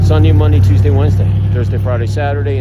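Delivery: each day punched out loudly; loud emphatic list. Real, not acted. Telling viewers his son works all week, loudly emphatic. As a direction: hammer every one of "Sunday, Monday, Tuesday, Wednesday" to show it never stops.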